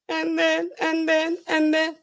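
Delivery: high, halting mock sobs; fake crying. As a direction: pretend to blubber between words. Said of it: "figure out how to cry".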